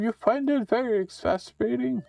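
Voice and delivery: witch voice. Acted. Witch cursing the player into a frog, high and gleeful. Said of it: "I read it in her voice".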